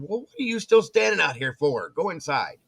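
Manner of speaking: husband ordering his wife inside, loud and urgent; urgent shout. Retold or imitated. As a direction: bark it like there's a bear out there. Ordering wife indoors, bear nearby.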